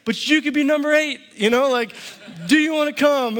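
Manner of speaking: high, eager invitation; fired up. Retold or imitated. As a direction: sell it hard with excitement. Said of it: "I'm all fired up".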